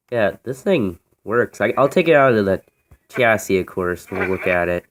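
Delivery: ordinary delivery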